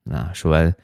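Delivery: usual speaking voice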